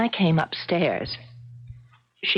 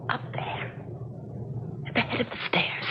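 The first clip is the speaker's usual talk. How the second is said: breathy hush, eyes fixed upstairs; hushed whisper; 4 semitones higher, 8 dB quieter than usual. Acted. Woman whispers to the man, pointing out the cat on the stairs, hushed whisper. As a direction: whisper it so the cat won't hear.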